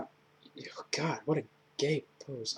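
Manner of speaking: breathy whispered aside, under the breath. Real, not acted. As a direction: whisper it like a secret comment. Aside about a photo pose.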